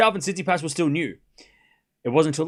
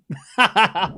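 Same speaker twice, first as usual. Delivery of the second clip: amused laugh; 3 semitones higher, 4 dB louder than usual. Real, not acted. Laughing at Fils' attitude.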